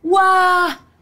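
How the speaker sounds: plain reading voice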